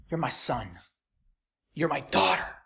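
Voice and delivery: low, soft, lightly voiced dad; a hint of a tender father, low and soft; tender father's claim. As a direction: say it like a father holding his child close. Voicing a father claiming child.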